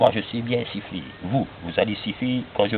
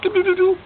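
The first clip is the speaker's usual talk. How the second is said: high tooting sound; 3 dB louder than usual. Pretend. Imitating the magic trick sound.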